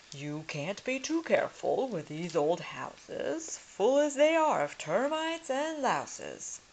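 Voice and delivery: pompous lord. Acted. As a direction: play the nosy inspector scoffing. Lord Cuckoo-Face inspects old house.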